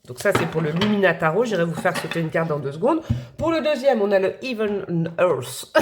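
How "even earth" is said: English accent on the tarot's name, drawn out; slow, put-on English accent. Shown as a demonstration. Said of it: "accent anglais".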